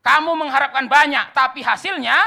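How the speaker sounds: loud, high proclamation of scripture; full-force preaching. Acted. Preacher reading a verse to the congregation, loud and impassioned.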